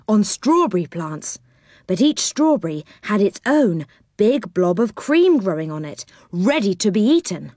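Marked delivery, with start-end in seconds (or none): none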